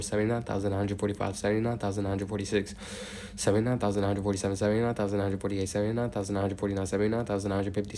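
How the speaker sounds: steady, rhythmic number chant; monotone counting. Real, not acted. Counts aloud toward eighty thousand.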